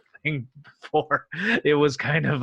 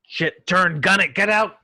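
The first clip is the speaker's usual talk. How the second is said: louder, higher panic commands; urgent orders; 5 semitones higher, 5 dB louder than usual. Acted. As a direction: bark the escape order. Torpedo incoming, captain orders.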